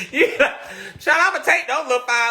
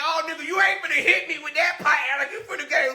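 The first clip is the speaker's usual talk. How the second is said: fast, higher fake bravado; mock tough talk; 7 semitones higher, faster than usual. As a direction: act not scared, bucking up. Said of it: "trying to act like I ain't scared".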